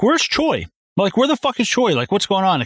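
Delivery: high-pitched, incredulous questioning; mock agitated demand. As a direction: demand an answer, voice jumping up. Acts out insistent, baffled demand.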